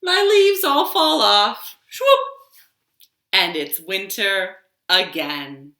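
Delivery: a tree telling its seasons, slow and expressive; tree character. Acted. Librarian reading a picture story to toddlers, storytelling voice. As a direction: read it as the tree, savouring each season.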